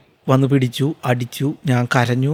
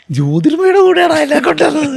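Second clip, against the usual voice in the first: much higher, louder bragging to a friend; excited boast; 13 semitones higher, 6 dB louder than usual. Retold or imitated. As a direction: show off the news, bursting with pride.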